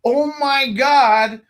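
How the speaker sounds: high, slow amazed gasp; thrilled teen. Retold or imitated. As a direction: drag it out like a teenager who can't believe his luck. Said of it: "You're like".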